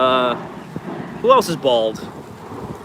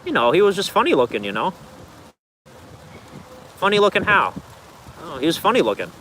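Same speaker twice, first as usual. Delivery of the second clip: put-on lilting Minnesota accent, mostly pitched up; Minnesota Fargo accent; 4 semitones higher than usual. Streamer quoting Fargo for viewers, attempted Minnesota accent. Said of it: "Speaking of Fargo accent".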